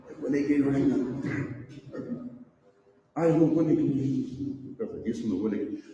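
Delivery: low, quick grumbled excuse; imitating a reluctant churchgoer; mock excuse.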